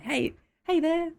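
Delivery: high, friendly Aussie greeting; exaggerated Aussie street hello; turned-up Aussie accent. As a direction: lay on the Australian accent for passers-by. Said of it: "the Aussie filter turns up just a bit".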